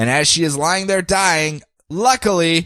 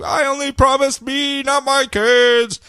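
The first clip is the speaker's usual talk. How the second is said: Deathstroke; 12 semitones higher, much slower than usual. Retold or imitated. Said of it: "Deathstroke's like".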